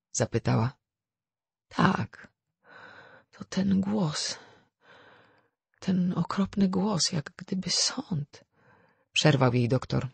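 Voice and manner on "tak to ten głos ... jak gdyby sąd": high, slow, halting recollection; dazed and haunted, dragging the words out; a frightened woman just out of a faint; shaken woman. A narrator's reading.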